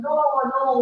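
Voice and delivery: ordinary delivery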